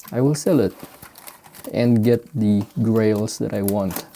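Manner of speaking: usual speaking voice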